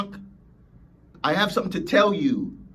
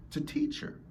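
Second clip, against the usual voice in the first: higher, breathy whisper; 11 semitones higher, 8 dB quieter than usual. Shown as a demonstration. Speaker whispering the key point again. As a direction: breathe it like a secret.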